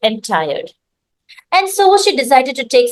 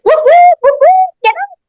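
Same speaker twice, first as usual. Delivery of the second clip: high loud woof woof, get out; playful dog barking; 6 dB louder, faster than usual. Acted. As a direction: bark it out in a high yappy voice. Dog barks and demands.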